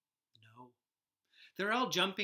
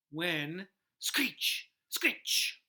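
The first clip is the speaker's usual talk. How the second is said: whispered screech; 5 dB louder, much slower than usual. Acted. Scary noise in the night.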